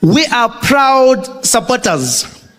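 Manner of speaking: slow, halting speech with odd pauses; Jacob Zuma speech. Retold or imitated. Zuma reading a party speech, slow and halting. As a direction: read it like a proud politician mangling the script. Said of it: "Comes out there, confident, cadence".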